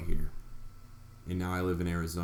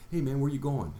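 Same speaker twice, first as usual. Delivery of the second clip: bright, higher friendly call to a stranger; breezy hello, pitched up; casual greeting; 7 semitones higher than usual. Retold or imitated. Nervous flyer greets the guy in line, lightly friendly. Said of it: "That's what I say".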